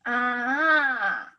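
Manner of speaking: long "Ah" with a turning point in its pitch; third tone. Shown as a demonstration. Teacher moves body to show the tone, long bending "Ah". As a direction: stretch "Ah" and bend it like a wave. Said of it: "So it goes down and then up".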